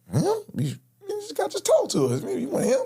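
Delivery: high, disbelieving; can't believe he picked that man; incredulous. Retold or imitated. Telling his co-defendant in disbelief. Incredulous reaction in retold robbery.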